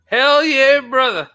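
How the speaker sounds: high, loud mock-Texan holler; badly faked Texan accent; English-sounding Texan. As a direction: whoop it like a hammed-up Texan. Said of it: "That's the most English Texan".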